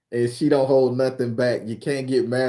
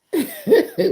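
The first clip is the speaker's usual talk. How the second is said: loud squealing laugh; burst of high laughter; 7 dB louder than usual. Real, not acted. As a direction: crack up at the memory. Laughing at the memory.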